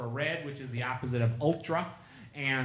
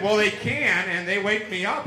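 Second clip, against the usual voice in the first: excited retelling; 6 semitones higher, 11 dB louder than usual. Retold or imitated. Rick excitedly tells his claim.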